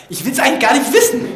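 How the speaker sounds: loud, high mock-exasperated cry; exasperated outburst. Bored student reacting to in-law vocabulary, mock-exasperated outburst. Acted exasperated thought in portuguese lesson.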